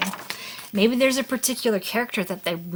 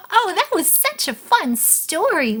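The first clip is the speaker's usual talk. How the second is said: playful excitement; 7 semitones higher, 4 dB louder than usual. Pretend. Modelling talk to the child afterwards.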